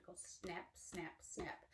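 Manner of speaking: soft hushed snaps; whispered snap. Pretend. Teacher whispering alligator snaps to children. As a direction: breathe the snaps quietly.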